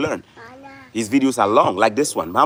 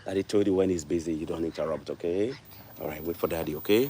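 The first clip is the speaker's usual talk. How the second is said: gentle fatherly correction; 7 semitones lower, 9 dB quieter than usual. Real, not acted. Father gently correcting interrupting child.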